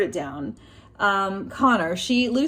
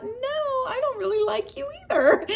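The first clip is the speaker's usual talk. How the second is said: high, fast, cracking up on every word; blurting it out through giggles; laughing confession. Retold or imitated. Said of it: "And I thought".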